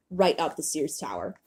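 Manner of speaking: usual speaking voice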